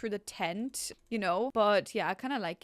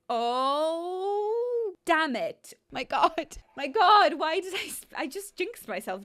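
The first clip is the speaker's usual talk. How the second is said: long rising "oh", then loud, high exclamations; dismayed outcry; 7 semitones higher, 7 dB louder, slower than usual. Real, not acted. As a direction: wail in dismay at the spin result. Bedroom spin lands on dreaded result.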